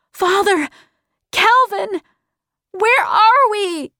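high, excited calling out; shouted. A narrator's reading. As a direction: call to them high and urgently. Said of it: "she shouted".

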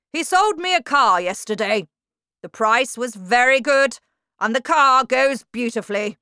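a hulking tyrant praising her friend; bullying headmistress. A narrator's reading. Trunchbull voiced in story.

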